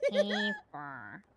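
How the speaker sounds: very slow, soft put-on voice with a laugh; Mumbles impression. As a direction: draw the words out slowly, half laughing. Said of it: "I've been working on my impression".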